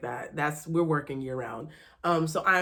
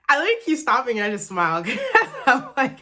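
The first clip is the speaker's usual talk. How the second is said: giggling through the words; happy laughter; laughing; 6 semitones higher, 5 dB louder than usual. Real, not acted. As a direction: laugh out of pure joy. Laughing while telling happiness.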